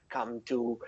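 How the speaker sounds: conspiratorial gnome whisper. Acted. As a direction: hush it like a dangerous secret. Gnome drops to secret aside.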